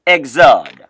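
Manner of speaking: Exode said "eg-ZOD"; low, firm Exode, second syllable falling; French pronunciation. Shown as a demonstration. Teacher shows beginners how French says it, Exode as "eg-ZOD". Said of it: "Let me hear you say again".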